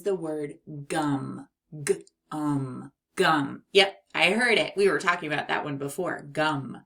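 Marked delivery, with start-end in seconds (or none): none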